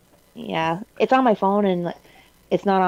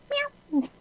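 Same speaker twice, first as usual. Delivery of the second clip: cat meow; 8 dB quieter than usual. Shown as a demonstration. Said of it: "I just, random, just".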